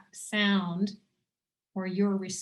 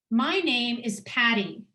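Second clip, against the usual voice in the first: firm, slightly louder "My name is Patty"; said with confidence; 3 dB louder than usual. Shown as a demonstration. Facilitator modelling a confident statement for the group. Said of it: "say it with confidence".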